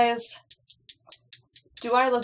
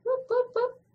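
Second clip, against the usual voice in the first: whoop. Real, not acted. Playful cheer in game.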